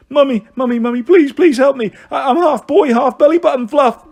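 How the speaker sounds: high, loud, pleading blubber; hammed-up childish wailing; blubbing boy. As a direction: beg mummy through mock tears. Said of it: "blubbed Harry".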